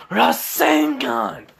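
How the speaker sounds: high, drawn-out battle call; attack cry. Acted. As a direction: stretch out the move's name way up high.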